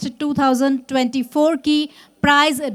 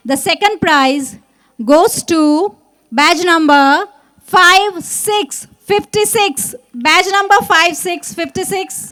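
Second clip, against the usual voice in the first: booming prize announcer; 3 semitones higher, much slower than usual. Acted. Prize winner announcement on stage.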